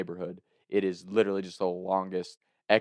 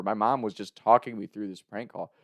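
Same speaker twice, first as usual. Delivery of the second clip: ordinary talk, nothing marked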